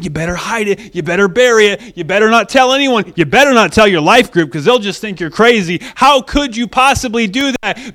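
urgent warning building to loud, high accusation; acted accuser working up to a scolding; maximizing. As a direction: pile on the shame, rising in pitch and volume. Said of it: "he maximizes it".

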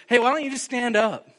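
high, loud call across a crowd. Retold or imitated. Paul calling to the lame man in the crowd, loud call. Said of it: "He says in a loud voice".

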